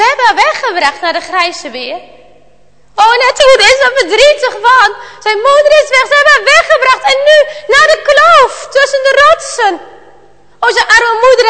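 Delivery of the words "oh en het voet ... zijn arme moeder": higher, louder storyteller lament; raised, emotional telling of the loss; dramatic lament. A narrator's reading. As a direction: wail the loss to the children. Sad news of mother taken.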